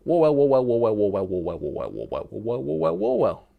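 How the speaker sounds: tongue-twister warm-up, saying it as fast as you can; rapid tongue twister. Shown as a demonstration. Streamer takes a viewer's challenge, rapid repeating tongue twister. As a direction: rattle off Warwell fast, pitch wandering up and down. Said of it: "Warwell ten times quickly".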